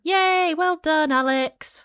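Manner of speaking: high, bright cheer of praise. Real, not acted. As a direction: cheer him on like a proud parent. Cheering a test score.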